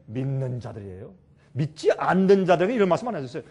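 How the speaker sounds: usual speaking voice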